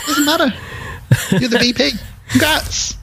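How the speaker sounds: high-pitched, breezy dismissal; hammed-up cheery boss; mock congratulations. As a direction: wave it all away in a high voice. Mimicking the absurd promotion.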